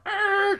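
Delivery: high-pitched vocal creak; imitated door creak. Kicking open old station wagon door, imitated creak. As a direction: squeal out a high door noise.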